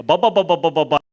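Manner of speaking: gagging ba ba ba noises; smelling the lake, gagging before spitting; disgusted retching ba. Shown as a demonstration. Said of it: "We use one syllable before we say the disgusting thu".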